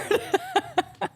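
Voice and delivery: high, thin giggle; laughter. Real, not acted. Storyteller defending the house visit, giggling.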